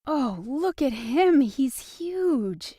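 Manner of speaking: excited and amazed; delighted high cry of wonder; fascinated. A narrator's reading. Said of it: "she said in fascination".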